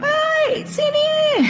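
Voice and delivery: very high shouted summons; mock screaming mother call; shrill yelled call. Shown as a demonstration. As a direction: screech for the child across the room.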